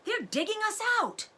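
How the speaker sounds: high, loud alarm; urgent shout. A narrator's reading. Father fox warns family of diggers, loud alarm. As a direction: raise the alarm over the digging. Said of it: "he shouted".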